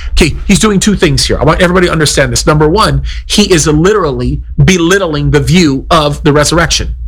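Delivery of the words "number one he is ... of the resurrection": ordinary delivery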